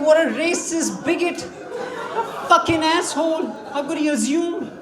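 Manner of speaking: mock outrage. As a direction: rant like you're offended but it's funny. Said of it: "One part of me was so angry".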